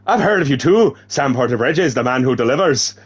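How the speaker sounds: put-on Irish woman's lilt; badly faked Irish voice; accidental Irish accent. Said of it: "she's Irish again now".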